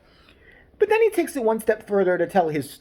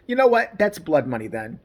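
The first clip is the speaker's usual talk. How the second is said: ordinary talk, nothing marked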